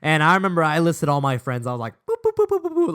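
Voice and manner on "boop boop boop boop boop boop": high-pitched playful boops; squeaky boops ticking off a list; rapid booping. Retold or imitated. Boy lists all his friends, high cheerful booping.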